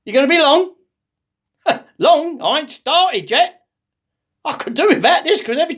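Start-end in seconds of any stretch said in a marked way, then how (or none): 0.1-0.7 mock impatient call, high and loud; calling through door. 2.0-3.7 mock indignation, high and drawn out; comic exasperated reply, slow and high; exasperated retort. Interrupted man answers through door.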